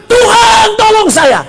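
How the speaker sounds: high, loud cry for help; shouted plea. Acted. Sinking disciple begging God to save him, high shout. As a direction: yell to heaven as if drowning. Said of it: "dia bilang, teriak".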